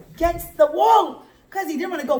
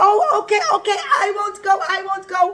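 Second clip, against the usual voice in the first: loud, high, flustered giving in; play-acted alarm, raised voice; panicked backing down; 7 semitones higher, 5 dB louder, much faster than usual. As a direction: give in hastily, as if caught by an angel. Bilam backs down before angel.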